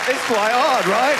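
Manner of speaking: put-on comic English accent. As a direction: lilt it like a mock Englishman.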